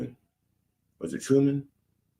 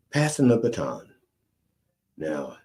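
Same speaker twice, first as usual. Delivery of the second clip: usual speaking voice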